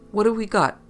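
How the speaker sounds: put-on RP accent. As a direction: try your best posh-police RP accent. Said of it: "I asked in my best R P accent".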